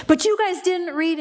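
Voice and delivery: high-pitched mock accusation; retold indignant protest; refuelling anger. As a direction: imitate her winding herself back up. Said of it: "she starts to refuel it".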